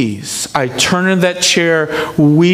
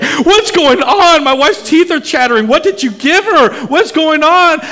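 alarmed shout; 11 semitones higher, 4 dB louder than usual. Retold or imitated. Husband shouting at doctors over wife's chattering teeth, alarmed. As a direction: demand answers from the nurses, voice up high. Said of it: "I'm like".

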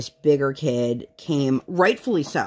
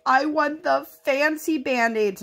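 put-on high, slow whiny child voice; high, drawn-out toddler whine; crying toddler; 12 semitones higher, slower than usual. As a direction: whimper it out like a kid denied. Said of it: "she started crying".

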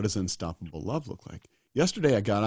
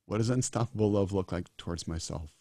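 ordinary delivery

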